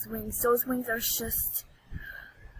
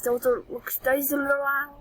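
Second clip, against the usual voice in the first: ordinary talk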